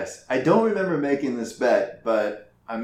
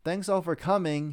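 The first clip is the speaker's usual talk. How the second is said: sarcastic; 5 semitones higher, 3 dB quieter than usual. Acted. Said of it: "he cried sarcastically".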